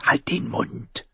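slow, voiceless shushing order; very soft, drawn-out angry whisper; hissed whisper. Acted. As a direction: whisper it sharply to silence him. Arya hisses while hiding.

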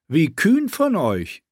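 sarcastic queen. Acted. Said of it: "sagte die Königin".

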